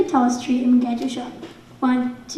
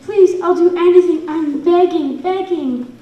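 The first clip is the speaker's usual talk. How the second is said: loud, higher desperate troll plea; begging troll; 4 semitones higher, 5 dB louder than usual. Acted. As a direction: beg Thor loudly for mercy. Dropped troll pleads with Thor.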